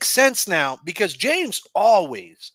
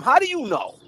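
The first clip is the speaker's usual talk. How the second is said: ranting; 11 semitones higher, 3 dB louder than usual. Acted. Ranting at Gladys.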